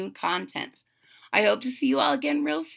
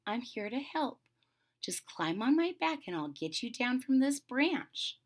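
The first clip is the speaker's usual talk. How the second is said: soft, reassuring voice; softly; 2 semitones higher, 7 dB quieter than usual. A narrator's reading. Fuji coaxing a frightened kitten down, softly. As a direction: keep it hushed to soothe someone scared. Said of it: "spoke softly to the frightened kitten".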